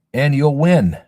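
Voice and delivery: loud, gleeful payoff; triumphant. Real, not acted. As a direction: punch it out like a victory. Gloating punchline to viewers.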